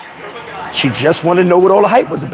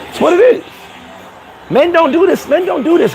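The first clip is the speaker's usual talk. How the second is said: high emphatic rant; 10 semitones higher than usual. Real, not acted. Ranting about gossiping men.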